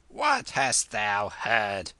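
put-on old lady snaps a sharp question, slowly; snapped. A narrator's reading. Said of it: "she snapped".

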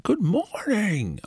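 very high, slow, grand announcer greeting; train announcer voice. Retold or imitated. Imitating the train voiceover guy greeting passengers, high and slow. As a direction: stretch out a showy good morning. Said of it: "this amazing person like".

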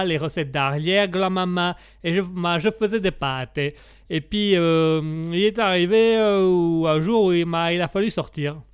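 put-on sing-song Italian accent, swinging on each phrase; theatrical mock Italian accent. Italian guest recalls his pasta trade, sing-song Italian accent. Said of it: "comme on l'entend à mon accent".

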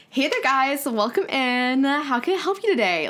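bright high-pitched retail greeting, up an octave; demonstrating her old high work voice to her cohost; high customer-service voice. Shown as a demonstration. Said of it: "Like, I go up an octave".

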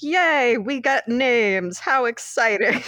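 1 sarcastic yay. Retold or imitated. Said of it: "I'm like".

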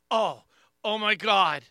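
loud acted cry of pain; hammed-up sudden agony; mock pain outburst. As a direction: groan out loudly as if pain just hit. Acting brother's sudden pain attack.

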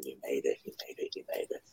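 soft, low repeated cheer; hushed retold cheer. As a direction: imitate a cheer under your breath.

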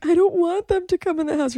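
high, childish mock whine; mock panicky self. She mocks herself watching the show, high childish whine. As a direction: whine like a silly kid. Mocking her own past reaction.

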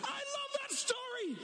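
a sudden excited cry; excited shout. Real, not acted. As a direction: shout out your love for the story. Exclaims love for the story.

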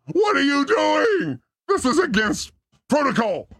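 mock outraged protest. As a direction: protest with stretched-out, theatrical alarm. Retold protest at being filmed.

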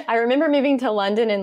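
ordinary talk

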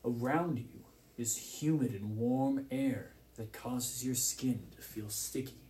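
ordinary talk

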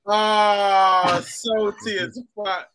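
normal talking voice

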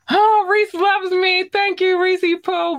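high, bright squeal of thanks; delighted squeal. Real, not acted. Host thanking a viewer in a delighted squeal. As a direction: squeal it out, overjoyed.

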